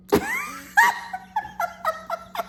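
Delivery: a sudden high burst of laughter. Real, not acted. Laughing at Kyle's drama, high cackle.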